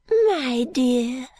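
put-on an eccentric old woman calling her friend dear; old lady's voice. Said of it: "said she".

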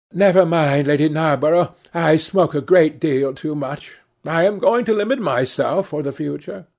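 lightly voiced Lady Ruxton. A narrator's reading. Lady Ruxton reassuring her hostess, lightly voiced lady. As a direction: answer graciously, lightly voiced.

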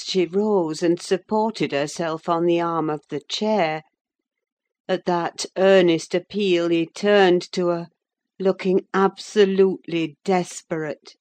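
usual speaking voice

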